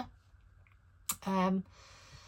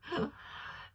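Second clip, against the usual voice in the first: soft unvoiced chuckle; breathy laugh; 9 semitones higher, 8 dB quieter than usual. Real, not acted. Laughs at own bad luck.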